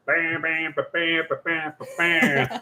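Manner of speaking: soft imitated horn fanfare; quiet "bam ba bam" horn noises; mouthed fanfare. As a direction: toot out a little fanfare with the mouth. Mouths a fanfare after announcing.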